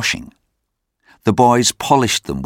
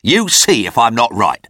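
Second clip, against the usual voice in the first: emphatic, higher-pitched pronouncement; stern board member; 4 semitones higher, 3 dB louder than usual. A narrator's reading. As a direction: insist on it as a grim prediction. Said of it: "said the man who earlier had called Oliver a fool".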